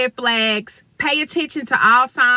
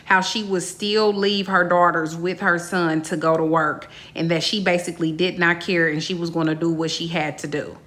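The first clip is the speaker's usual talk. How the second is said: formal statement; 5 semitones lower than usual. Acted.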